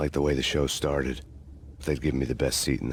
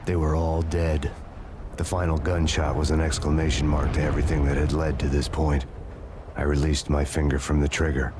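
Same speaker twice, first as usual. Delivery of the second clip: noir narration; 6 dB louder than usual. A narrator's reading. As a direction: tell it like a weary detective. Grim noir narration, loud and slow.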